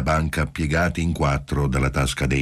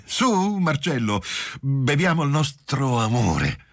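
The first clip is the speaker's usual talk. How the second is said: much higher, tipsy toast call; drunk and laughing; 8 semitones higher than usual. A narrator's reading. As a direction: raise the glass giddily. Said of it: "Ebbra ridente".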